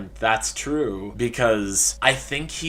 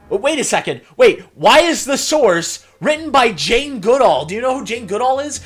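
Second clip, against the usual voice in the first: much higher, louder disbelieving rant; incredulous outrage; 10 semitones higher, 8 dB louder than usual. Acted. Researcher baffled by an odd source, high incredulous outburst. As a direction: demand answers in high-pitched bafflement.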